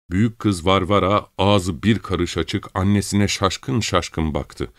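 ordinary delivery, nothing marked

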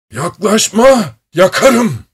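high, loud threatening shout; boğuk, titrek bağırış. A narrator's reading. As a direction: shout a warning to keep them away, voice pitched far up. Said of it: "Boğuk, titrek bir sesle bağırdı". (tiz ve yüksek sesle tehditkâr bağırış)

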